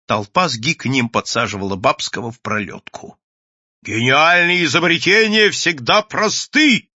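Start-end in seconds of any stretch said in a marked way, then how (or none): 3.8-6.9 high, loud, slow proclamation; shouting. A narrator's reading. Mad inventor on the cabman's back, shouting. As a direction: declare it like a crazed genius. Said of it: "кричал Бабский".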